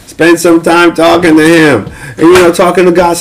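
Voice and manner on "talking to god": ordinary talk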